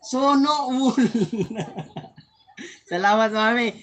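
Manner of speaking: giggling through the thanks; laughing thanks. Real, not acted. Laughing while thanking viewer. (tumatawang pasasalamat)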